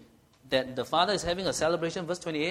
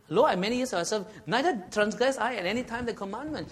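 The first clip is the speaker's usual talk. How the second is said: protesting holiness; 6 semitones higher, 4 dB louder than usual. Retold or imitated. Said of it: "He was protesting his holiness".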